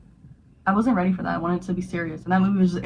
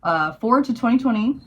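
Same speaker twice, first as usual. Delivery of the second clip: ordinary talk, nothing marked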